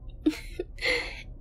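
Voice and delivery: airy giggle, rising up high; tiny breathy giggle. Acted. Girlfriend giggling as she feeds her boyfriend. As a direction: giggle under your breath, pleased.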